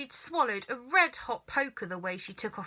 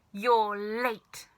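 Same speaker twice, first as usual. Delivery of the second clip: a sharp, irritable snap from a cranky grandma; grumpy old woman snapping at her grandson; snapped. A narrator's reading. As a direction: cut him off short and sour. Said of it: "she snapped".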